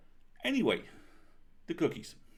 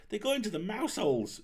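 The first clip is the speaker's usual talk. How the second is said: high, louder imitation of an old aunt; aunt's voice; 11 semitones higher, 5 dB louder than usual. Niece imitating her aunt telling the tantrum story. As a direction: lift the voice to mimic the aunt's retelling. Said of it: "she always says".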